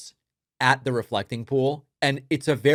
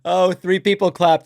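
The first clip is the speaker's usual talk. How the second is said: higher, louder, amused; laughing incredulity; 7 semitones higher, 10 dB louder than usual. Real, not acted. Host laughing at Trump clip, incredulous. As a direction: laugh it out in disbelief.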